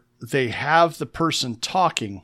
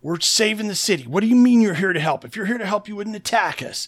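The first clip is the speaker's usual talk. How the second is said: higher, put-out voice of a scientist; indignant scientists; 6 semitones higher, faster than usual. Retold or imitated. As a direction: play the offended scientist protesting. Voicing the scientists' indignant objection.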